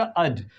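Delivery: अध said as a quick "ud"; very short "uh" vowel in अध; short schwa. Shown as a demonstration. Teacher answering her own question, short schwa. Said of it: "very short आ निकलेगा".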